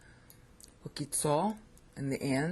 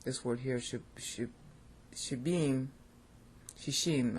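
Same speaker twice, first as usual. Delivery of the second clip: usual speaking voice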